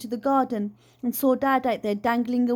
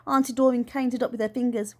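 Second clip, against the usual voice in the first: plain reading voice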